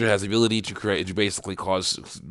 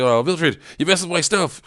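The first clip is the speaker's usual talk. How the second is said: ticked off; 8 semitones higher than usual. Retold or imitated. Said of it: "she's ticked off".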